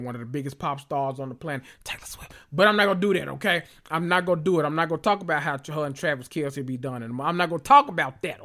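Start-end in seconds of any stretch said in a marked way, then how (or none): 0.0-2.3 gossipy whisper of a name; whispered shade, almost silent. Real, not acted. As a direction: drop to a secret whisper. About to shade someone secretly.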